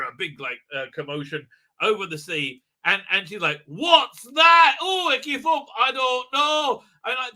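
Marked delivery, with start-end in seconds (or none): 3.7-6.8 high, hammed-up panicked cluelessness; mock alarm. Companion hearing commotion over the sea, high mock alarm. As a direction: imitate her high and loud, stretching the end.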